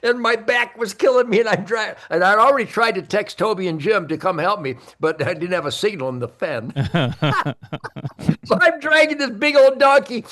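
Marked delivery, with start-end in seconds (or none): none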